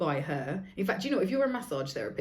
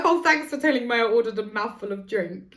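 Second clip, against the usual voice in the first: sarcastic thanks; 4 semitones higher, 8 dB louder than usual. Retold or imitated. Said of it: "I'm like".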